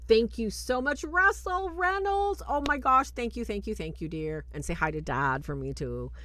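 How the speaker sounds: high, delighted gushing thanks. Real, not acted. Host thanks a donor during the live show, high and excited. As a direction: gush over the donor.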